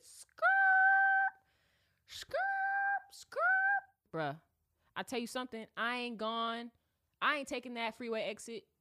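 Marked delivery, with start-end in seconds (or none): 0.0-3.9 high shouted tire screech, drawn out; loud high skrrt sound effect. Retold or imitated. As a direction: scream the skid like peeling out. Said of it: "I said".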